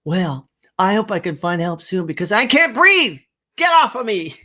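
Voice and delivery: playful protest. Pretend. Wife laughs, pinned down.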